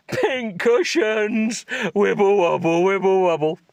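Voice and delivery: high, slow, goofy sing-song; silly jiggle voice. Pretend. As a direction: wobble the words like jelly. Silly voice mocking breasts.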